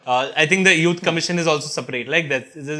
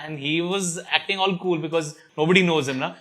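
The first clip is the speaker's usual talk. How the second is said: ordinary delivery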